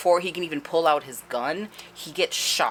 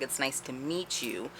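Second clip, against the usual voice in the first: quiet, slow, careful words; slow halting; 9 dB quieter than usual. Retold or imitated. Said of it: "speaking really slow and halting".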